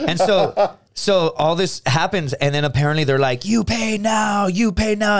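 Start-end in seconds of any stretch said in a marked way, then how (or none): 3.4-5.2 high, slow put-on demanding voice; mock insistent demand. Imitating hookers demanding payment from the friends, mock insistence. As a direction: imitate someone demanding money, pitched well up. Retold argument over payment.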